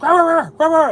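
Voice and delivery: high, loud mock dog barking. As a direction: bark like an angry dog at the plumber.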